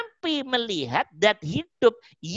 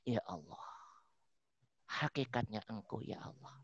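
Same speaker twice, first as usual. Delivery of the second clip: hushed inner prayer; 9 semitones lower, 20 dB quieter than usual. Pretend. Heart's inner prayer voiced.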